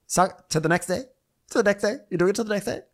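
high, incredulous echo; mock disbelief. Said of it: "She goes".

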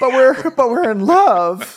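high, loud, drawn-out whine; hammed-up lovesick sobbing plea; mock wailing. Jilted boyfriend begging his date after three dates, high mock wail.

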